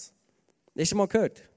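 ordinary delivery

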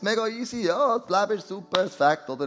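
lightly acted, high-pitched breezy reply; mock carefree. Falling man answers the bystander, mock carefree. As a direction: toss it off as if all is great.

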